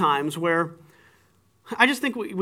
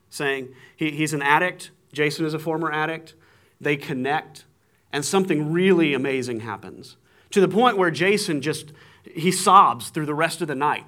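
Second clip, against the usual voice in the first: ordinary delivery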